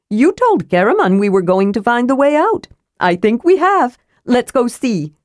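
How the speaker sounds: cheerfully matter-of-fact; Tass the kender, chipper. Acted. Tass urges Tika.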